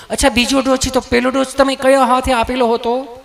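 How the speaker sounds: lightly voiced nurse, kindly asking; loving nurse. Nurse asks nervous Gabho which arm, lovingly. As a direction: coax the answer out sweetly. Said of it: "તમે કેટલું પ્રેમથી પૂછો છો".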